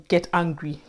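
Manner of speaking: ordinary talk, nothing marked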